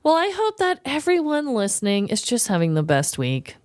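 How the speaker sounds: normal talking voice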